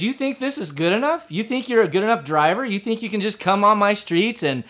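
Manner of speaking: high, mounting indignant questions; imitated scolding from the bench; berating judge. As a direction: pile on each challenge like an irate judge. Judge berates him, repeating challenge.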